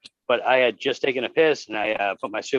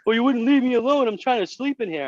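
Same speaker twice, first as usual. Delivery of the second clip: higher, louder put-upon whine; whiny homeless guy; 11 semitones higher, 4 dB louder than usual. Retold or imitated. The homeless guy in the porta potty whining back, high and loud. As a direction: play the annoyed sleeper who got woken up.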